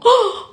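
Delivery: loud, high intake of breath; sharp gasp. Real, not acted. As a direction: gasp as if suddenly caught out. Reacts about her house phone.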